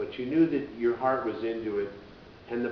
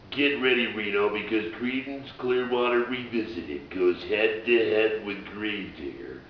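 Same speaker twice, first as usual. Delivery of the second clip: drawn-out, loud event promo read; big, slow announcer imitation; commercial announcer voice; 5 dB louder, slower than usual. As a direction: hype it like an arena ad. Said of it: "You gotta use that voice".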